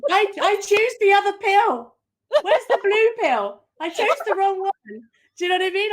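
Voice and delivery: high, loud hammed-up despair; mock panic. Coach joking with the host, hammed-up panicked wail. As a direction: wail like you picked the wrong pill. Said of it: "mock regret of choices".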